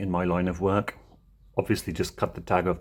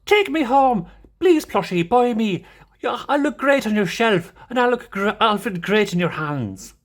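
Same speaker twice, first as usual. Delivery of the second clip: high, loud, pleading Irish voice; Mango, Irish accent; 12 semitones higher, 4 dB louder than usual. Acted. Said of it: "like, an Irish accent".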